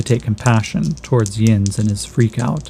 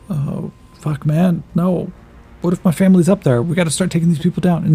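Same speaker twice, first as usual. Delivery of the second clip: higher, louder, rushed panic; panicked scared kid; 6 semitones higher, 3 dB louder than usual. Acted. Scared youth panics to the elf about his family, high and fast. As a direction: blurt it fast, spiralling into panic.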